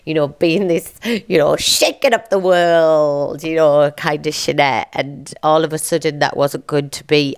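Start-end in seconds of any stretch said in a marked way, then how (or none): none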